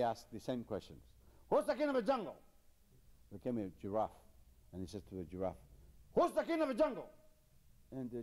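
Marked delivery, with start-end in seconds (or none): 1.5-2.3 squeaky high bully of a lion, demanding; bossy lion. Acted. Nasty lion demanding an answer from the animals, in a high squeaky bark. 6.1-7.1 squeaky high bully of a lion, demanding; bossy lion. Acted. Nasty lion demanding an answer from the animals, in a high squeaky bark.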